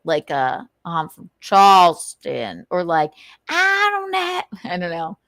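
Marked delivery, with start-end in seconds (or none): 0.8-2.7 slow, loud Southern drawl; exaggerated Charleston Southern accent; Southern man. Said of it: "Men with Southern accents sound like idiots". 3.5-4.4 very high Southern twang; cartoonish Southern accent, pitched up; idiotic Southern man. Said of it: "Men with Southern accents sound like idiots".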